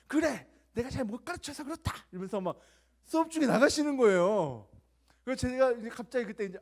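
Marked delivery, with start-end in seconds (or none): 0.1-1.9 loud, high furious outburst; angry teacher shouting. Retold or imitated. Teacher snapping at the class before walking out, loud and high. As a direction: yell it like an offended teacher storming out. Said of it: "화를 내면서".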